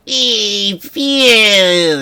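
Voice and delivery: high, loud cartoon ghost cry; Stinky. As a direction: stretch it out high and silly. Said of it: "Stinky the best".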